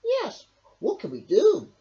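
high, keen question; high hedgehog voice; Hedgehog asking. A narrator's reading. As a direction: join in eagerly. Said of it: "asked Hedgehog".

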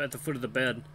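ordinary delivery, nothing marked

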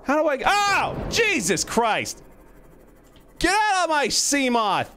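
very high, drawn-out panicked shriek; real alarm, slow high screams; panicked yelling. Real, not acted. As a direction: yell frantically at an attacker. Creature attacks the vehicle in game.

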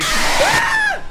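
high, loud shriek at a jumpscare; startled scream. Real, not acted. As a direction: scream out in sudden fright.

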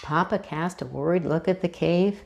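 ordinary delivery

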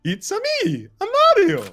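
Mario impression. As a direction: squeak it out like a cartoon plumber. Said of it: "do an impersonation of, uh, of an Italian".

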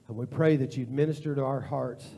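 ordinary delivery

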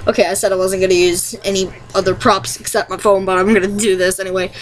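ordinary talk

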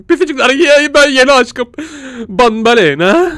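high, loud swooning announcement; lovestruck. Pretend. Gamer telling viewers about his new crush.

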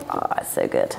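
normal talking voice

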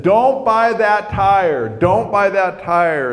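high, slow, drawn-out plea; urgent warning. Retold or imitated. Man stopping the woman mid-haggle, urgent drawn-out warning. Urgent repeated warning, set against earlier take.

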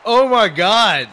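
high, loud, drawn-out disbelief; exasperated outcry. Real, not acted. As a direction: cry out in appalled amazement. Exasperated reaction to the answer.

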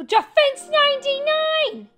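high, loud hype; thrilled stat shout; excited announcement. Real, not acted. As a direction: call it out like a big win.

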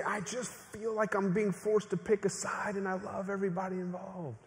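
mildly pleading, imagined confession; plaintive confession. Acted. Child of divorce confides feeling caught between sides, plaintive.

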